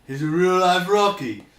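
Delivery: loud, slow Rocky; full mock Rocky. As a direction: boom it like Rocky. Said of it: "Rocky voice".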